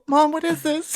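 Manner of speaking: put-on high-pitched confused little kid; six-year-old. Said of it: "some six-year-old".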